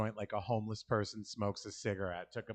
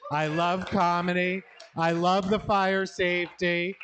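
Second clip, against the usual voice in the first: excited sign-off; 6 semitones higher, 5 dB louder than usual. Real, not acted. Cheery closing to the crowd.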